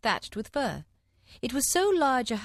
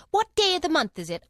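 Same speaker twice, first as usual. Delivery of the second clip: loud, high fussy Hatter; a fretful hatter demanding; 8 semitones higher, 6 dB louder than usual. A narrator's reading. Hatter voiced.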